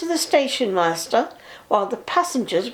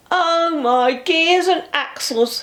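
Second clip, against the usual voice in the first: slow, mildly groaning; groaning bus; 7 semitones higher, slower than usual. A narrator's reading. Bertie groaning while toiling up the hill, slow. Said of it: "he groaned".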